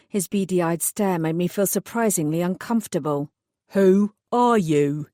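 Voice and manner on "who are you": slow, gruff East End man, louder; a burly East End mechanic taking over; East End accent. Acted. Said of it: "distinctly East End accent".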